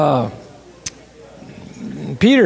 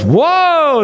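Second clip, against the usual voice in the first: amazed whoa; 8 semitones higher than usual. Retold or imitated. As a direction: let the whoa leap up in wonder. Second whoa, much higher.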